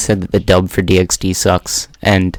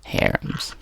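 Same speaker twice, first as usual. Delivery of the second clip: mock whisper; 12 dB quieter than usual. Teasing echo of a friend's word.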